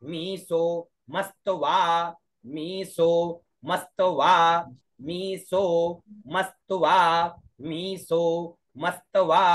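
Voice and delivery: मस्तवा with half म, half स and त joined; emphatic recitation of मस्तवा; pronunciation drill. Shown as a demonstration. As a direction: say मी सो मस्तवा firmly, each cluster tight. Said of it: "क्या उच्चारण हुआ".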